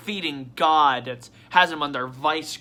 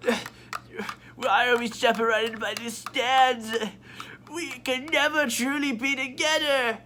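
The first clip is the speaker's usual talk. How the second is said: Akechi; 7 semitones higher than usual. Acted. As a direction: lament a doomed bond in a hammed-up voice. Akechi's tragic parting in a skit.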